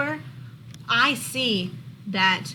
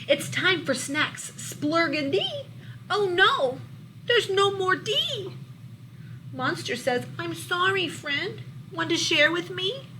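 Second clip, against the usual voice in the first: lively, louder storybook read-aloud; reading to children; 7 semitones higher, 3 dB louder than usual. A narrator's reading. Teacher reading a monster book to her class, lively storybook voice. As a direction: bring the picture book alive for the class.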